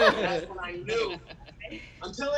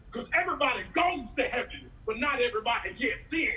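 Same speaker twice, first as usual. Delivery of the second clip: raised-pitch mock sermon voice; preaching pastor; 6 semitones higher than usual. Pastor going in too hard at church, mock preaching. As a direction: proclaim it like an overzealous preacher. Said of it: "the pastor said".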